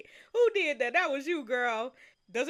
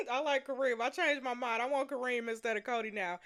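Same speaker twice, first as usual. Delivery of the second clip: normal talking voice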